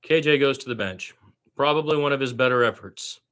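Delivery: lively radio play-by-play call of a Spurs game. Acted. Fan practicing radio play-by-play.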